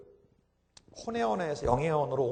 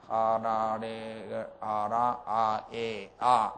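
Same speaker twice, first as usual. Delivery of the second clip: lower, garbled, lips not moving; lips held still; 7 semitones lower, much slower than usual. Shown as a demonstration. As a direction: speak with only the tongue. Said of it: "입은 가만 놔두고 혀로만 가지고 한번 해봐요".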